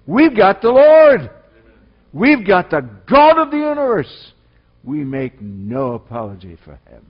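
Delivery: loud, high-pitched triumphant proclamation; full-force pulpit cry; preaching. Acted. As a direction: shout the victory over the congregation.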